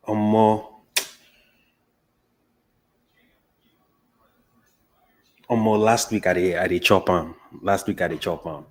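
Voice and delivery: casual Nigerian Pidgin; Nigerian accent. Shown as a demonstration. Guest answering about pounded yam, Nigerian Pidgin. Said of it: "talk in your accent".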